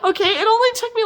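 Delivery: high, laughing and fast. Real, not acted. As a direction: laugh your way through it. Still laughing about the chapters.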